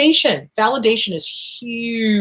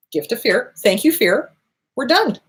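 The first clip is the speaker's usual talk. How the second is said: ordinary delivery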